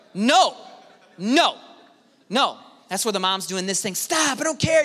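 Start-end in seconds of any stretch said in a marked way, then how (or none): 3.9-4.8 mock mom. Mom scolding kid at fair.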